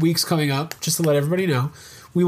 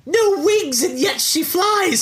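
much higher, slow squeaky leprechaun; marveling tiny leprechaun; 12 semitones higher, slower than usual. Acted. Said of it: "I'll do the voice".

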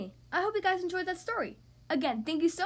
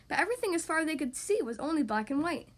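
plain reading voice

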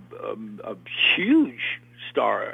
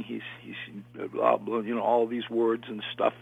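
ordinary talk, nothing marked

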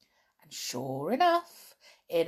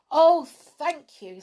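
high, loud, drawn-out thanks; grateful; 10 semitones higher, 11 dB louder, slower than usual. A narrator's reading. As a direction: gush with slow, heartfelt thanks. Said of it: "said Jolly gratefully".

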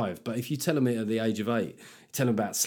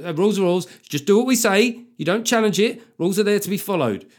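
higher, louder rigid teacher voice; strict teacher; 7 semitones higher, 5 dB louder than usual. Retold or imitated. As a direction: bark the rules at the kids. Mock strict teacher at door.

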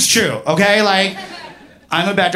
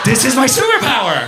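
high, exultant proclamation; mock triumph; 7 semitones higher than usual. Comic discovering a superpower, high-pitched triumph. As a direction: declare it like a newly crowned superhero.

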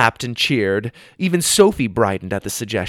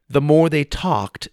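plain reading voice

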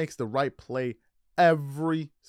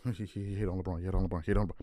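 a hint of whiny mocking fan voice, softer; 6 semitones lower, 6 dB quieter, much faster than usual. Mocking LeBron fans' reaction, lightly whiny parody. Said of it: "And they were like".

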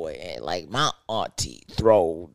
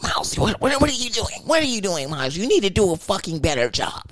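the boss hovering and scolding the cook to do a fucking better job; nagging boss; 8 semitones higher, faster than usual. Retold or imitated. Boss nags the cook at work, high and sharp.

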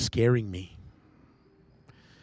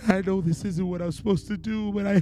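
high and strained, on the edge of tears; high-pitched tearful plea to God; desperate pleading prayer; 9 semitones higher than usual. Retold or imitated. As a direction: beg God to save you, voice cracking upward. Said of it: "I would say".

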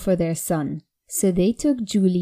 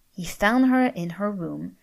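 usual speaking voice

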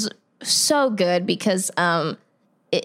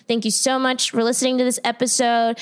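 usual speaking voice

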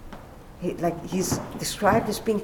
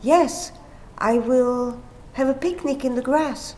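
cheerful neighbor; 5 semitones higher than usual. Retold or imitated. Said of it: "the neighbor replies".

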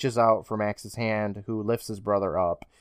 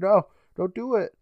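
high-pitched acted plea; mock pleading; 8 semitones higher than usual. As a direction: beg him not to, higher. Retells Cutter pleading, shaking head.

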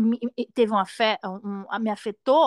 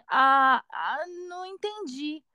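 puzzled listener; 4 semitones higher, much slower than usual. Retold or imitated. Listener failing to follow a learner, imitated puzzlement. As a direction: mimic a puzzled listener, drawn out and higher. Acts out a listener not understanding.

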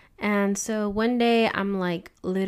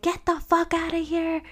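high-pitched mock yell, only slightly louder; retold angry yell; 9 semitones higher, 3 dB louder than usual. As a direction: imitate her chasing someone off. Retold confrontation with the exposer.

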